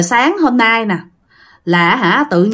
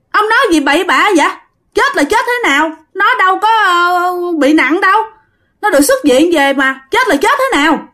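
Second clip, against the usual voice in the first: shocked disbelief; 8 semitones higher than usual. Retold or imitated. Soldier on the phone, hearing his friend died, in shocked disbelief. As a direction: cry out that it can't be true.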